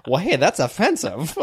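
mock offended voice.

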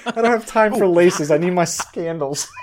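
put-on high, loud childish whine; whiny kid yelling. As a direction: whine like a kid who's late. Kid yelling to mom for sandals.

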